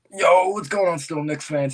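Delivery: yelled, high hype greeting to the chat; loud greeting. Real, not acted. Streamer yelling hello to Knicks fans, hyped. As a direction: blast it out to the fans. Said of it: "I hurt your guys' eardrums".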